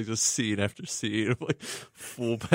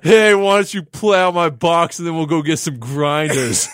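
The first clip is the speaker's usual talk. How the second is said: put-on loud, high New England trash accent; brash Boston-area accent; 7 semitones higher, 9 dB louder than usual. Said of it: "the dirty New England trash accent".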